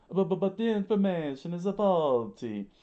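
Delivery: high, loud stammered protest; freaking out. Acted. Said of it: "Mister Three freaked out".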